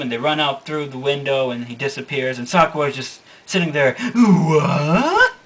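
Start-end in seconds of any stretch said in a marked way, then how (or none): 4.1-5.3 stunned what. Acted. Sakura stunned by the chaos.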